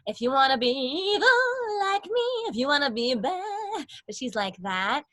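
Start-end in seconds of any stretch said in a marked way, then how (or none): 0.0-3.9 sweet-voiced evil queen tease. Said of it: "she kinda talks like this".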